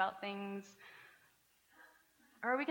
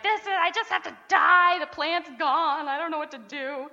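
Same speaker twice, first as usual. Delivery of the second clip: loud, higher, slowed mock yell; yelling at God; 6 semitones higher, 10 dB louder than usual. Jonah yelling up at God over the plant, hammed-up mock yell. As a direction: wail it like a drama king. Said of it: "like, yelling out at God".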